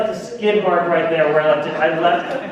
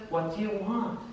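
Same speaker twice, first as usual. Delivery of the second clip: hushed, softer asking; soft question; 2 semitones higher, 9 dB quieter than usual. Acted. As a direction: drop to a soft, open question. Same question to blind man.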